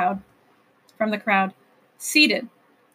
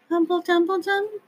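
high, soft creature murmur like a harp; soft whisper; 7 semitones higher than usual. A narrator's reading. The lady Whangdoodle greeting the king, a soft high murmur. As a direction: murmur it sweetly, almost whispered. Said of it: "she whispered".

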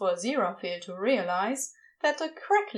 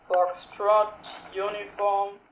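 Crowley slowly realising; 3 semitones lower, slower than usual. A narrator's reading. Crowley decoding, trailing off.

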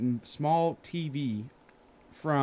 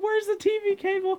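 much higher, slow put-on voice for the friend; mock quote voice. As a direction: squeak out the friend's question. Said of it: "He said".

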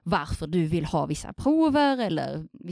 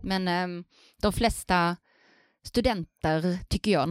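normal talking voice

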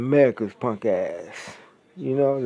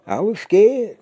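higher, hammed-up mock officer whining he was scared; mock scared cop; 6 semitones higher than usual. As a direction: play the cop faking fear, pitched up.

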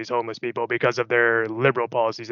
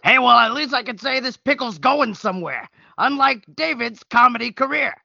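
much higher insult-comic roast voice, a bit louder; Don Rickles impression; 11 semitones higher, 4 dB louder than usual. Host's sidekick roasting David as a high-pitched insult comic. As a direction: jab at him like an old roast master. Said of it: "as long as it's Don Rickles doing the voice".

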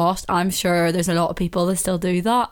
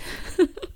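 high giggle; 11 semitones higher, 5 dB quieter than usual. Real, not acted. Laughs after her impression.

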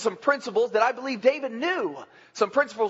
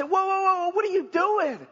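alarmed cry; 7 semitones higher than usual. Retold or imitated. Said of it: "they say".

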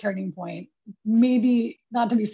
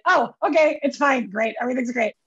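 flustered agreeing; 4 semitones higher, 4 dB louder than usual. Retold or imitated. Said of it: "I was like".

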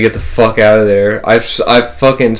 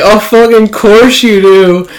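tag; 8 semitones higher, 3 dB louder, slower than usual. Retold or imitated. Mocking reply to a coworker's boast.

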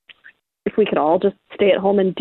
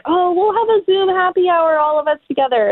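mock cheerful; 9 semitones higher than usual. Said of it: "everyone was like".

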